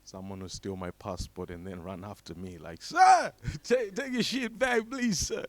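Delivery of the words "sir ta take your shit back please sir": high-pitched put-on begging voice; mock pleading. Thief chasing him to return the passport, pleading in mock desperation. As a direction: plead comically, starting with a loud high cry.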